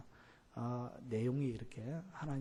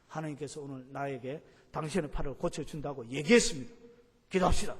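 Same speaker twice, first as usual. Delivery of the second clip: building to a much louder, forceful command; blunt pushy announcement; 7 dB louder than usual. Shown as a demonstration. Preacher demos the wrong, blunt way of declaring a healing, growing loud. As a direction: bark the order to pray. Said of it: "이렇게 그만 들고 일하면 안 됩니다".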